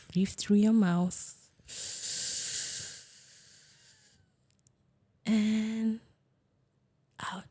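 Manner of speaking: calm, soft meditation guide; guided meditation. Acted. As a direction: ease them slowly into the breath. Leads a breathing exercise.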